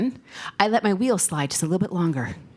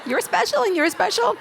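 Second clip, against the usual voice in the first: laughing; 9 semitones higher than usual. Real, not acted. Speaker affirms audience members, laughing.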